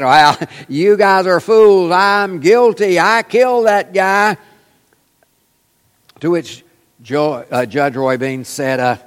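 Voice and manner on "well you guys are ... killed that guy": loud, drawn-out mock boast; arrogant gloating. Acquitted defendant taunting the jury that just freed him, arrogant gloating. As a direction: crow it slowly and loudly, full of swagger. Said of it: "he arrogantly stood up and said".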